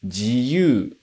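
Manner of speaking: "jiyu" as ji-yu, sounds separate; teacher models "jiyu" with ji and yu apart; uncontracted jiyuu. Shown as a demonstration. Teacher showing freedom word: "jiyu" split ji-yu. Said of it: "primeiro, a liberdade".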